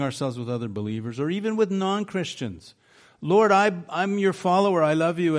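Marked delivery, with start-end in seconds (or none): 3.2-5.4 higher, louder acted appeal; mock pleading protest. Believer complaining to the Lord about others' blessings, pleading protest. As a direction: plead your case in an imitated complaint.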